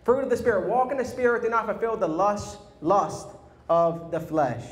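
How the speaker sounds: fired-up recitation. Acted. Recites verses as a rallying cry.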